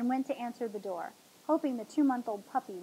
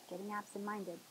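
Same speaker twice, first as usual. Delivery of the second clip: softer, self-directed aside; muttering to herself; 10 dB quieter than usual. A narrator's reading. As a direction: say it softly to yourself. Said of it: "To herself she said".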